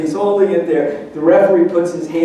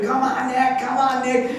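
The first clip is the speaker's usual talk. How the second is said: mock crowd chant, pitched up high; crowd cheering; 7 semitones higher, 4 dB quieter than usual. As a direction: echo the crowd urging him on. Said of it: "they're just screaming".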